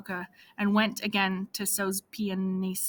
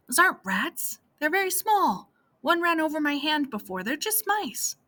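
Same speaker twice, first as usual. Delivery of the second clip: higher, gentle coaxing; reassuring; 6 semitones higher than usual. Acted. Vladek telling Anya the rats are mice, gently reassuring. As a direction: soothe her with a small lie.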